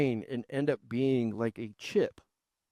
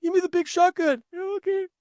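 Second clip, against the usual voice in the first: much higher, cartoonish video game voice; game character voice. Recalling playing Doom as a kid, in a high cartoon game voice. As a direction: squeak it out like a kid's game hero.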